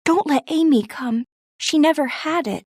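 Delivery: put-on high, worried young girl, mildly anxious; a hint of fear for her sister. A narrator's reading. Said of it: "said Beth anxiously".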